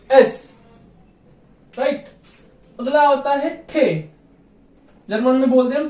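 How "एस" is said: एस said loud, crisp s; German es. Shown as a demonstration. Teacher modelling German letter एस. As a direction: hit एस clearly. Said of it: "जिसको हम German में pronounce करते हैं एस".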